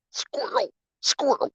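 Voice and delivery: excited squirrel call. Real, not acted. Calling out about a squirrel.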